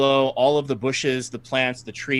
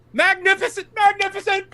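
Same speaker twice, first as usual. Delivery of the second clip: much higher, delighted villain voice; gleeful villain. Acted. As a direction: savour each word like a gloating fiend. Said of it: "and he says".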